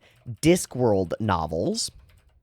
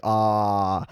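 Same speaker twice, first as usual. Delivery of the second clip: Death voice sample; 3 semitones lower than usual. Shown as a demonstration. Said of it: "that's the voice that he uses".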